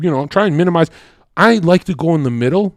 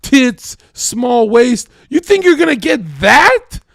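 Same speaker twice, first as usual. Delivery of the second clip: shouting rant; 8 semitones higher than usual. Real, not acted. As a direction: yell it at them, worked up. Heated rant at the co-host.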